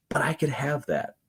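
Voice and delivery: soft hopeful whisper. Retold or imitated. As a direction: breathe it out as a private hope. Hopeful thought voiced.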